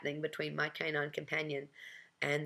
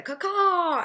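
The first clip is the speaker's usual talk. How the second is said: high, loud, long squawk; sudden crow-like call; loud caw-caw; 11 dB louder than usual. Retold or imitated. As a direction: screech it out like a bird to stop someone. Old woman flags narrator down.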